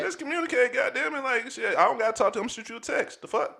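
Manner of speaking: high-pitched fed-up complaint; exasperated. Retold or imitated. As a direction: sound fed up and incredulous. Continued exasperated venting.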